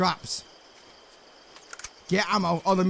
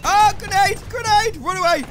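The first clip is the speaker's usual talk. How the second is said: high, loud warning cries; panicked shout; 10 semitones higher, 5 dB louder than usual. Real, not acted. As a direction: shout it while fleeing a blast. Fleeing a grenade in game.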